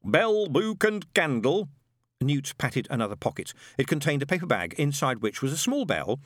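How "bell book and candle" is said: put-on high, barking old sergeant; old witchfinder sergeant. A narrator's reading. Shadwell drilling Newt through the kit list, barking. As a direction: bark out the checklist like a drill sergeant.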